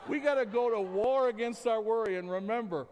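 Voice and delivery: loud rallying preach; rally the church to fight worry; preaching at full force. Real, not acted. Preaching climax.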